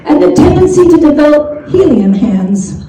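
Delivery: slow, mock-solemn warning read; hammed-up warning label. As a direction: announce it like a product warning. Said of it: "it should say".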